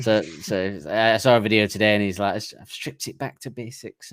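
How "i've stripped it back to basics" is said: slow, soft mock Scouse, deliberately awful; half-hearted Liverpool accent, dragged out slowly; bad Scouse accent. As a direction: do a terrible Scouse impression of Miles. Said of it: "awful attempt at a Scouse accent".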